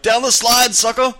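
high, loud taunting yell; gloating shout. Pretend. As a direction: gloat over your board game win. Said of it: "Yeah, I'd be like".

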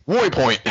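put-on "Boy Point", way turned into woy; deliberate mispronunciation. Shown as a demonstration. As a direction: play up the oy in "Boy Point", pitched high. Jokes on mispronouncing Waypoint.

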